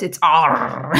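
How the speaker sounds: loud pirate arrr. Shown as a demonstration. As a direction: growl it like a pirate. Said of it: "or with my best pirate voice".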